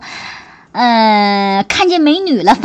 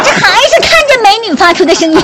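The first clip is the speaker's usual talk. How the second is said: laughing; 7 semitones higher, 4 dB louder, much faster than usual. Real, not acted. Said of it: "teasing repeat, laughing".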